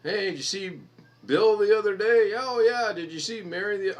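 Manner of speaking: high, loud gossipy mock chatter; mimicking chatty workers. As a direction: ham up idle coworkers gossiping. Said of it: "five of 'em standing around a pallet".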